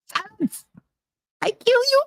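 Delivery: very high, strained puppet threat; high-pitched comic villain puppet impression; Ahmed. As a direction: squeal a mock menacing threat. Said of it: "I love Ahmed".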